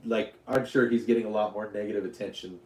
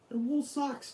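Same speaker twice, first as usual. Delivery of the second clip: much higher, squeaky and soft; 14 semitones higher, 7 dB quieter than usual. Real, not acted. Showing off his socks.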